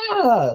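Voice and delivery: a high, weak little war cry; recruit's timid war face, high pitched; high pitched yelp. Retold or imitated. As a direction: squeak out a feeble battle cry. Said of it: "like a real high pitch".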